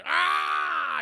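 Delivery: high loud mock war cry; hammed-up tackle yell; battle yell. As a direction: let out a charging yell. Football tackle action.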